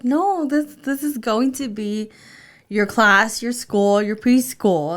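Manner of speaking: slow, loud, emphatic parent voice; retold mom explanation; patient explaining. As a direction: spell it out for a small child, stretched and firm. Mother retells explaining to child.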